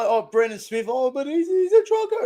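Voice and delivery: high, sneering mock accusation; mocking rival fans. Streamer mocking rival Broncos fans, high sneering voice. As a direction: mimic the smug rival fans mocking him. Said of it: "They're going out and saying".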